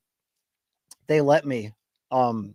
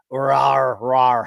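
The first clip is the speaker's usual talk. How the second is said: louder mock animal growl; playful growl; 4 dB louder than usual. Hyping up the stream chat, a playful mock growl.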